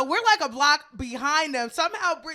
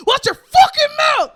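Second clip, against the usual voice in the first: very high, loud furious yell; angry woman hitting a man; retold furious shout; 12 semitones higher, 10 dB louder than usual. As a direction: scream it as you swing. She hits him with keys.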